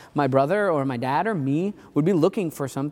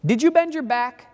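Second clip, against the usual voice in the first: higher-pitched imitation of a mother; lightly acted mom calling back a pointed question; mom's retort; 7 semitones higher, 3 dB louder than usual. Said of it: "my mom would almost immediately respond".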